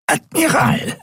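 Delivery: Admiral croaked high, pressed and nasal, falling. A narrator's reading. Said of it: "krächzte die gepresste, nasale Stimme".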